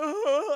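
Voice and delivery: high, loud mock wail; hurt wail. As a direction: cry out like a rejected giant. Giant's hurt cry, retold.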